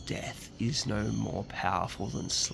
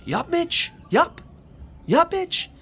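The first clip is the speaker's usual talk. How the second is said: high, loud wake-up call; imitating a father yelling out; imitated dad's yell; 10 dB louder, slower than usual. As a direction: call upstairs-downstairs like Dad rousing a kid. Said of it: "He'd yell out like that".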